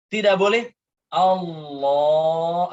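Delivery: "Allah" held on and on, slow and drawn out; overlong Allah. Shown as a demonstration. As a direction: stretch the last syllable of "Allah" far too long. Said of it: "saking panjangnya di sini". ("Allah" dipanjangkan berlebihan)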